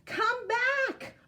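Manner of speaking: high calling shout after the dogs; a girl shouting to her huskies floating away. A narrator's reading. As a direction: yell out to the drifting dogs. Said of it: "Aloki shouted".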